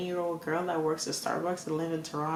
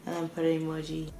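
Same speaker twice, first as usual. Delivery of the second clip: normal talking voice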